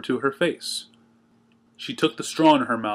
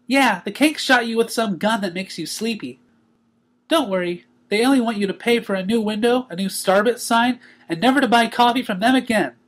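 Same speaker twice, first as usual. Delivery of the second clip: high, cheery pony voice; bright and teasing; Pinkie, joyful; 8 semitones higher than usual. A narrator's reading. Pinkie greeting the waking Twilight, high and cheery.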